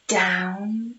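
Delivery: plain reading voice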